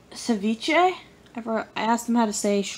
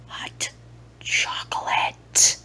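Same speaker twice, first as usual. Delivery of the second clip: drawn-out whisper; slow whisper; much slower than usual. Pretend. Vlogger savoring hot chocolate in a slow whisper. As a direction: whisper it slowly like a treat.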